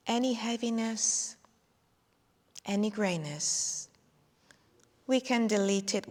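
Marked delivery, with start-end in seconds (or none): none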